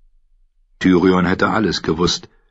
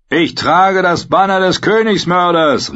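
high, loud jeering cry; mocking shout; 11 semitones higher, 4 dB louder than usual. A narrator's reading. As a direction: yell it to taunt the prisoner. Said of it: "rief er".

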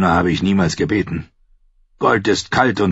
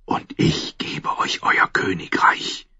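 slow, breathy temptation; a priestess tempting the king in a whisper; 4 dB quieter than usual. Acted. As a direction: whisper the promise slowly. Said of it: "flüsterte sie".

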